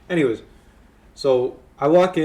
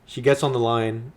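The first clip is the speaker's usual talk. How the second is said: usual speaking voice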